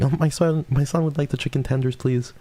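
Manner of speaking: high, sweet mom ordering for her son. Retold or imitated. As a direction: pitch way up as a doting mother. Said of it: "when my mom orders for me".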